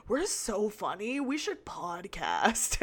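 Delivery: slow, lazy bro voice; a hint of a baked frat guy; stoned dude voice.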